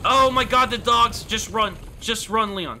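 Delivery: high frantic shouting; panicked yelling. Real, not acted. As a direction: yell in real panic. Panicked gamer yelling at dogs.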